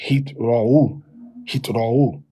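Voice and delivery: "hitra'u" said slowly; Tiberian reading. Shown as a demonstration. Teacher models Tiberian hitra'u, slow. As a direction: draw out "hitra'u" carefully. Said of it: "in the Tiberian pronunciation system".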